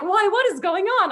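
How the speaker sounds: high, loud stage bewilderment; mock confusion. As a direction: play the confused crowd. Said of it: "supposed to be like".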